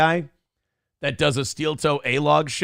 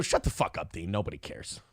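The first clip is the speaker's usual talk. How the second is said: hushed put-down; 10 semitones lower, 10 dB quieter than usual. Retold or imitated. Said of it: "I go".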